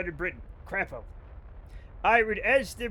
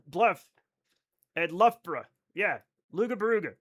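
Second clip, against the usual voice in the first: usual speaking voice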